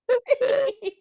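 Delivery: high-pitched trailing laughter; real high giggle. Real, not acted. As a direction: giggle helplessly after being silly. Laughs after silly singing.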